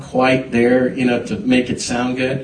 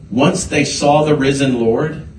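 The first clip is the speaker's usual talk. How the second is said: ordinary delivery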